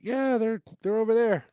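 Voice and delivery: put-on high, loud kid's excuse; eager fib. Kid fibs about his parents.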